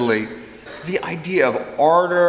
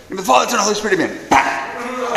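higher, punchy mock-angry blessing; mad priest; 7 semitones higher than usual. Priest blesses and smacks the troublemakers, mock angry. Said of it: "Well, he's so mad".

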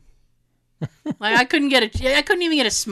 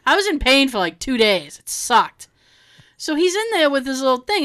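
usual speaking voice

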